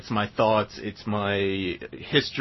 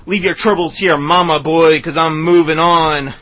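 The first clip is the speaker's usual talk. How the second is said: louder, higher menacing recitation; growing outlaw swagger, louder; cowboy poetry; 9 semitones higher, 13 dB louder than usual. Acted. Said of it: "This is called Psycho Killer Cowboy Poem".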